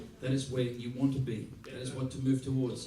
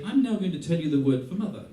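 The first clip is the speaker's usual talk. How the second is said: mock formal teacher; 3 semitones higher, 7 dB louder than usual. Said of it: "You say".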